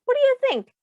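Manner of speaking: put-on storyteller quizzing young listeners; animated question. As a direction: pull the children in with a big lift. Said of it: "teacher asks the children".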